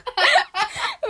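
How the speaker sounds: very high loud laughing shriek; high shriek of laughter. Real, not acted. Laughing fit.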